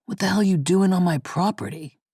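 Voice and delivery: lightly voiced gruff man calling out; a hint of a hostile farmer. A narrator's reading. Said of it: "a man's gruff voice called to her".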